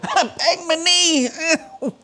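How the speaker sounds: high, slow, laughing through the pain. Real, not acted. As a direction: laugh it off after the hit. Laughing after banging knee.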